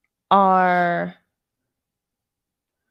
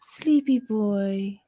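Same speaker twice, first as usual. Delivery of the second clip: cooing to a pet; 2 semitones higher, much slower than usual. Real, not acted. Cooing at the sleepy dog.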